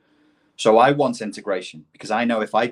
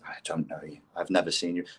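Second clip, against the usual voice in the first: quiet, low, dismissive bouncer; deadpan door staff denial; unmoved doorman; 4 semitones lower, 8 dB quieter, faster than usual. Acted. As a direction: stonewall the punter softly. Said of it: "When I do door work".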